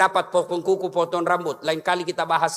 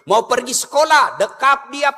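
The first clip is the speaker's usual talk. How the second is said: usual speaking voice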